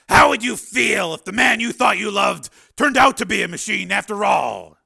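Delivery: loud, high, challenging character voice; anguished Steele. A narrator's reading. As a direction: throw the question out loud and high.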